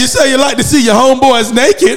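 high, laughing shout. Real, not acted. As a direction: crack up mid sentence. Howling at the joke.

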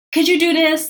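high, loud imitated boss demand; mock a condescending boss hounding an assistant; talking down. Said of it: "talking down to me saying".

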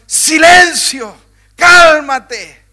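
loud slow command, high and forceful; commanding rebuke. A narrator's reading. Jesus rebuking the wind and sea, loud command. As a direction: order the storm to be still. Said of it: "reprendió al viento y ordenó al mar".

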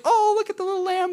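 high-pitched mock child delight; excited little kids. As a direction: squeal it like a thrilled kid. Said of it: "the little kids are like".

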